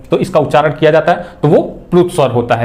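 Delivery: ordinary delivery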